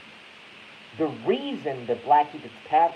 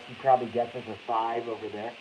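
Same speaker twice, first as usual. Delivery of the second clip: ordinary talk, nothing marked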